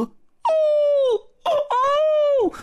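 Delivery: imitated honking calls, very high; high swan cry. A narrator's reading. Bull King turned swan flies up, high bird cries. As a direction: call like a bird taking flight. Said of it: "高叫着飞向了空中".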